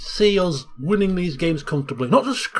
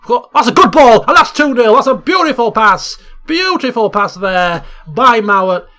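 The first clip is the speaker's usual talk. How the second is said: much louder, higher burst of joy; excited goal call; 4 semitones higher, 8 dB louder than usual. Real, not acted. Commentator celebrating a goal for his viewers, loud and thrilled. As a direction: shout the goal out in delight.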